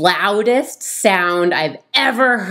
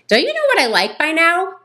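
snippy; 11 semitones higher, faster than usual. Retold or imitated. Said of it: "And she says".

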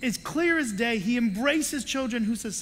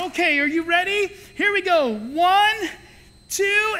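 louder, higher rising count; 8 semitones higher, 7 dB louder than usual. Retold or imitated. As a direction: build up the fall. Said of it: "And I said".

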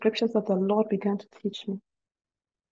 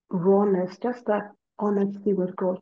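normal talking voice

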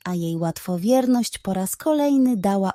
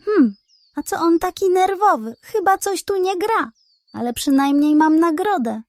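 high, puzzled hen voice; 7 semitones higher than usual. Acted. Hen puzzles over the pug's nervous exit, higher. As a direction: wonder aloud, a little suspicious.

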